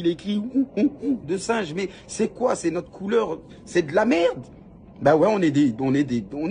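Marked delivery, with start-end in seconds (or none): 0.4-1.2 imitating monkey cries.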